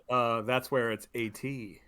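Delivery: letters said one by one; spelling words in front of kids. Shown as a demonstration. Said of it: "Oh, I spelled".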